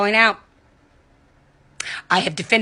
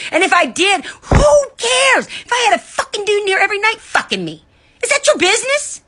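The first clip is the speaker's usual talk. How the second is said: crying furious shout; 11 semitones higher than usual. Real, not acted. Ranting at online critics, sobbing and shouting. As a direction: voice cracking high into a tearful yell.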